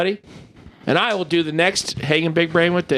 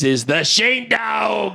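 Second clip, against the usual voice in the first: slow hyped host intro; announcer voice; 5 semitones higher, slower than usual. Acted. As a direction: announce the name slowly.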